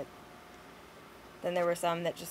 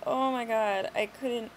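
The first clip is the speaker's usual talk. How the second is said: ordinary talk, nothing marked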